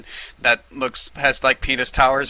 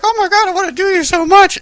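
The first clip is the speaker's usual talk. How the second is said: high loud lovestruck squeal; mock swooning gush; 7 dB louder than usual. Lovestruck mermaid over the prince, high squeal. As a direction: gush it breathlessly. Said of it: "He's like".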